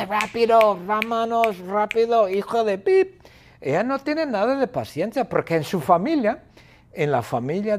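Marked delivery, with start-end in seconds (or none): 0.0-3.2 high, strained impatient shout; exasperated high-pitched nagging; impatient yelling. Acted. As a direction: hurry someone along in a high, urgent voice. Acting impatient Bertita yelling.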